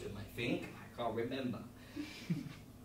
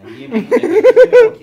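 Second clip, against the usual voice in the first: much higher and louder, slow, breaking into laughter; laughing loud exclamation; 25 dB louder, slower than usual. Real, not acted. Host to producer, laughing loudly in disbelief. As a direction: cackle it out in disbelief. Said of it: "if I ask my producer kindly".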